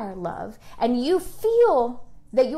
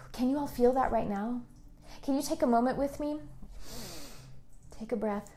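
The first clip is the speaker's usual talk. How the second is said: hushed meditative prayer voice; guided meditation; 3 semitones lower, 10 dB quieter than usual. Acted. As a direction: breathe the words to them gently. Leads a guided affirmation meditation.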